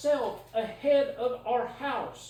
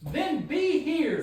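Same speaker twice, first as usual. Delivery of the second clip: raised voice; 4 semitones higher, 4 dB louder, much slower than usual. Real, not acted. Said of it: "Stop screaming at me".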